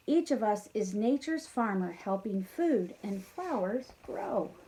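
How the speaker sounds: lively storybook rhyme, building then easing; reading a rhyme to children. A narrator's reading. Teacher reading the bees' rhyme to kids, lively. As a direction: read the rhyme brightly to the class. Rhyming ending of bee poem.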